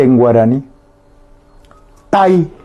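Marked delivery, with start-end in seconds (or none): 2.1-2.5 "tai" with the A stressed, clear oral vowel; "tai" as one beat led by a strong A. Shown as a demonstration. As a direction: lean on the A of "tai", short I after it. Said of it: "el acento le pongo en la A".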